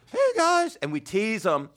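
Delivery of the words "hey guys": put-on very high, squeaky kid greeting; Brian's voice. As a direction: mimic a kid's absurdly high voice. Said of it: "he'd be like".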